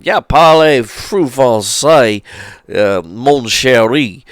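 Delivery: slow, high, loud hammed-up French cowboy accent; imitating Southern cowboys who speak French; mock French-speaking cowboy. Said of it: "they were speaking French with him".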